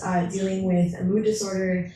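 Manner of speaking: ordinary talk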